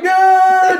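high, loud puppet scream; cartoonish high scream of a victim; mock scream. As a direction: shriek like a puppet being eaten.

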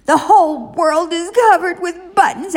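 high, hammed-up angry cry; storybook scream. A narrator's reading. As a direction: jump and shriek it like a tantrum.

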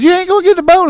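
high, loud imitation of friends; incredulous retold question.

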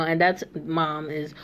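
ordinary talk

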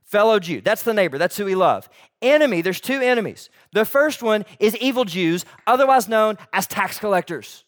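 loud, high, driving sermon delivery; preaching at full force. Acted. Pastor preaching hard to the church about enemies. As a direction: hammer the point home to the congregation.

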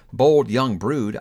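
ordinary delivery

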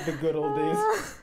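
put-on high, slow old-timer sigh; mock nostalgic. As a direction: sigh over the past like a grandpa.

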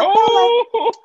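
squeaky high mocking squeal; mock the girl voice. Mocking the girly voice.

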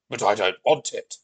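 put-on loud sulky Viking boy; sulky refusal. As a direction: refuse like a sulking child. Ardyn refuses again.

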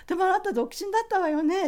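high, quick, ladylike query; a genteel teacher checking a fact; teacher's voice. Retold or imitated. Teacher's quoted question.

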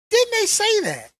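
high, loud and indignant; disbelief. Real, not acted. Vlogger doubting the casting news, indignant. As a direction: demand an answer.

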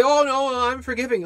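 high, mocking imitation of a churchgoer; mock self-righteous voice. Preacher mimics a congregant claiming to forgive, mocking. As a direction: sneer it out like a smug believer. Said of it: "and you say".

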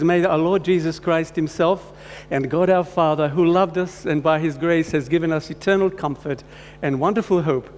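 ordinary delivery

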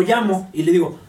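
normal talking voice

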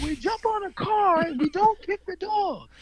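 mock children's sing-song answer; kids reciting. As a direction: recite it back in unison like schoolkids. Imitating kids answering the teacher.

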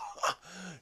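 high mock mad-scientist cackle; imitated villain's maniacal laugh. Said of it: "he's, like, laughing maniacally".

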